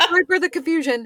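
quick, high, giggly fake politeness; laughing mock apology. Co-host keeps the joke going, giggly fake apology. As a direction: rattle it off sweetly through a laugh. Co-host plays along sugary.

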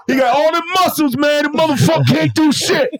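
high, incredulous mock heckle; imitated mocking boxers. Boxers ridiculing the muscular novice, mock heckling. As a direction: play a jeering gym onlooker.

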